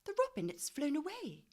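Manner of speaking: high, upset cry that the robin is gone; Lucy crying out to the others; dismayed cry. A narrator's reading. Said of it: "cried Lucy".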